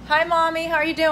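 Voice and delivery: high, loud call to mom; cheery greeting. Real, not acted. Greeting mom entering room.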